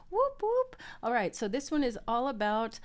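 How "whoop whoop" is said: high, louder whoop whoop; cheer. Acted. Host cheering the tarot deck's reply, high whoop. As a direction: whoop like a party horn.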